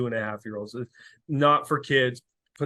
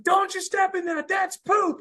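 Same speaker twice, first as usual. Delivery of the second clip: put-on much higher, loud childlike shout; kid yelling; 4 dB louder, slower than usual. Son yelling at people to keep away, high child shout. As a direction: yell like a little boy on guard duty. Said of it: "I heard him yelling".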